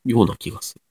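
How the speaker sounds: ordinary talk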